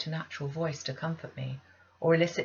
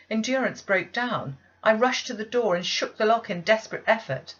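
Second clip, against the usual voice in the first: rising panic; 5 semitones higher, 7 dB louder than usual. A narrator's reading. Narrated panic building.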